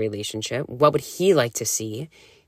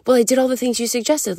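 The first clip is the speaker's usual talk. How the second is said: much higher and fast, mock panicked protest; frantic defending; 10 semitones higher, 4 dB louder, faster than usual. Retelling her panic to her coach, frantic and high-pitched. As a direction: rush it out like a flustered client pleading her case. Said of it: "'Cause I was like".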